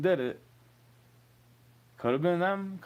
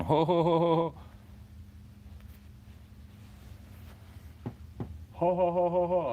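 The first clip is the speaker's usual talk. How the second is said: mocking ho ho laugh; 6 semitones higher than usual. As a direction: laugh it off like a jolly mocking Santa. Mocking laugh at online trolls.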